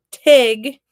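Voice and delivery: "tig" said as teg, loud; Wisconsin tag. Shown as a demonstration. Counselor shows Wisconsin speech, loud "tig" like teg. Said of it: "They say rag for rag".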